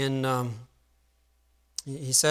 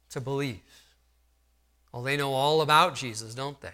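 ordinary talk

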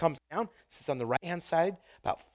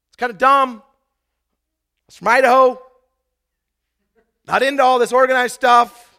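lively preaching; 10 semitones higher, 14 dB louder than usual. Acted. Preaching his own discipling story.

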